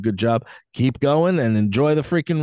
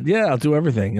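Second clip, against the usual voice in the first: ordinary talk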